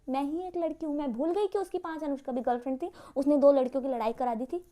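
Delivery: ordinary talk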